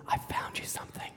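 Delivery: hushed stage whisper; breathy whispered aside. Retold or imitated. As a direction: whisper it secretively to your kid. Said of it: "he whispers".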